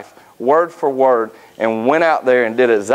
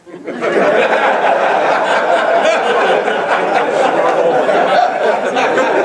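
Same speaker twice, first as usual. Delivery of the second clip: rising, breathy burst of laughter; real laughter; long laugh; 5 semitones higher than usual. Real, not acted. Laughing at wife's reply.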